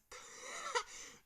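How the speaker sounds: a sudden high squeak of laughter; high laughing squeal; high-pitched giggle. Real, not acted. Laughs at name.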